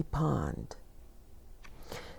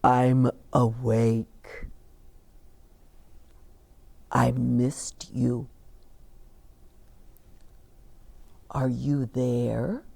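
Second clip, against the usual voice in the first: gentle bear voice; 5 semitones lower, 3 dB quieter than usual. Acted. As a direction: speak softly as a big sleepy bear. Bear's voice answering.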